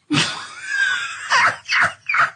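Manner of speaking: shrieking high laugh; high laughing fit. Real, not acted. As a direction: burst out laughing at the joke. Asks Rick for a ruler.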